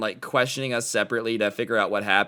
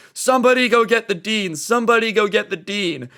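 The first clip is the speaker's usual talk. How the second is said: high-pitched urgent call; acted-out shout to onlookers; imitated yell for help; 8 semitones higher, 4 dB louder than usual. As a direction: call out like a teacher with his hands full. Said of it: "he's yelling at us".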